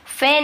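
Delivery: "फैन" as fayn; deliberately wrong closed vowel in "फैन"; wrong ay pronunciation. Shown as a demonstration. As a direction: misread "फैन" with a narrow, long e. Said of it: "फैन नहीं बोल रहा हूँ".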